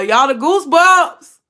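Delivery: excited burst of real feeling; thrilled goosebumps. Real, not acted. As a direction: let the goosebumps rise in the voice. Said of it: "but I have goosebumps".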